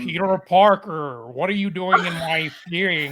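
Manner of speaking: Benedict Cumberbatch doing a bad American accent; fake American accent. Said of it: "Use my Benedict Cumberbatch voice, but in a bad American accent".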